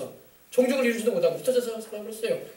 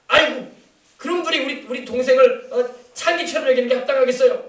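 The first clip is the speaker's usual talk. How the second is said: higher-pitched mock protest; indignant self-justification; 6 semitones higher, 3 dB louder than usual. Brothers answering Jacob's scolding about their sister, indignant retold protest. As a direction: retort as if fully in the right. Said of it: "자기들이 막 정당하게 했다는 듯이".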